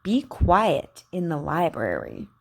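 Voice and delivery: slow, carefully shaped practice sentences; over enunciating. Shown as a demonstration. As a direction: model each Q word slowly and crisply. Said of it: "Just repeat after me".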